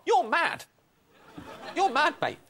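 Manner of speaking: high mock-scolding verdict; teasing scold. Real, not acted. Panellist teasing the quizmaster, high mock scolding. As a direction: tell him he's mad, grinning.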